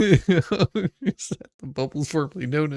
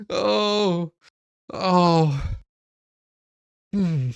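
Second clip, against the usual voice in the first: ordinary talk, nothing marked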